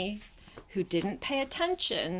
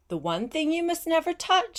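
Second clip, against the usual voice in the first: higher, louder warning on never touch; stern warning; 9 semitones higher, 5 dB louder, faster than usual. Acted. Witch warning Big Anthony about the pot, stern. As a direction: warn him sternly, leaning on the words. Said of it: "said Stregonona".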